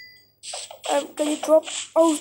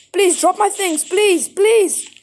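loud, higher begging; pleading; 4 semitones higher, 7 dB louder, slower than usual. Real, not acted. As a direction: beg with rising urgency. Begging spider to drop items.

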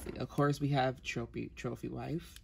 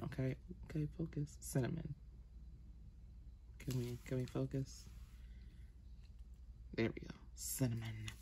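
hushed muttering at the camera; muttering to herself; 11 dB quieter than usual. Real, not acted. Camera not focusing, muttering.

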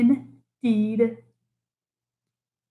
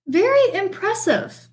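higher, bright mouse exclaim; 8 semitones higher than usual. Acted. Mouse praising Bear's headstand, bright exclaim. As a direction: gush with admiration. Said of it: "exclaimed the mouse".

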